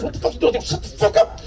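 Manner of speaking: high, strained mock fury; hammed-up hushed-down outburst; retold angry interruption. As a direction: imitate a panicked, angry telling-off. Acted angry reply to his offer.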